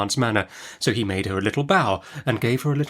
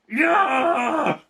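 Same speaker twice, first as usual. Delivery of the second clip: snarling yah. A narrator's reading. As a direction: bark it out to crush his spirit. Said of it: "with such a snarl at him".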